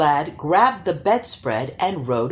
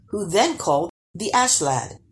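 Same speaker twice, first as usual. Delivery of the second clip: ordinary delivery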